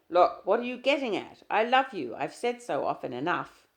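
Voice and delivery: loud, high protest; exasperated shout. Acted. Husband yelling at his doubting wife. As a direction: shout it in frustration.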